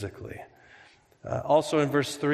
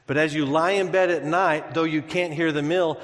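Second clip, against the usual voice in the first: ordinary talk, nothing marked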